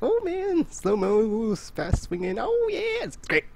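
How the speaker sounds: high, gleeful exclamations. Retold or imitated. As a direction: squeal with glee at the slow mo. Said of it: "It's like".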